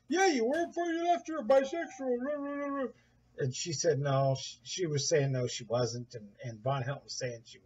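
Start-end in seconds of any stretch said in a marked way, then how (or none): 0.1-2.9 mock insistent husband. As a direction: drag out bisexual in a high taunt. Voicing Von arguing with wife.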